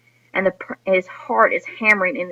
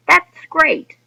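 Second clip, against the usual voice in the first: much higher, louder cheerful burst; 9 semitones higher, 5 dB louder than usual. Shown as a demonstration. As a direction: sound genuinely thrilled about the trip. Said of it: "the tone of the son's response is very cheerful".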